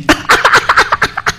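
high, breaking-out chuckle; amused laugh. Real, not acted. Host jokes the coach is facing Italy, amused laugh. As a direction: laugh at your own joke.

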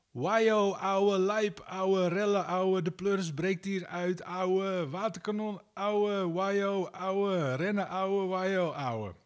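high, slow Amsterdam street-slang voice; hammed-up excited street-kid rant, pitched high; [name]. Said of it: "dat klinkt ongeveer zo".

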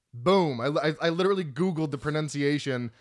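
usual speaking voice

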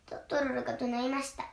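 ordinary delivery

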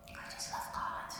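breathy whisper, barely voiced. Real, not acted. Speaker confesses his love for God in a near-silent whisper. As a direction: breathe it like a secret.

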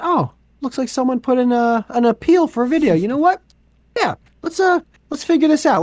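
put-on high-pitched, obliging office kid; helpful young worker. YouTube kid opens an appeal at his desk, eager to help. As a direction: act an eager new employee reading a request.

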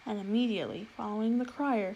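plain reading voice

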